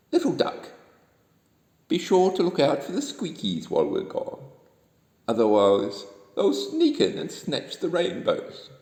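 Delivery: put-on storybook warning to a child character; lightly voiced moon man. A narrator's reading. Man in the Moon warning Little Duck.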